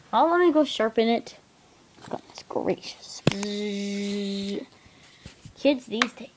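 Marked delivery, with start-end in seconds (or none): 2.1-6.3 breathless whispered grumble; whispered aside. Pretend. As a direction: mutter it under your breath. Teacher mutters aside.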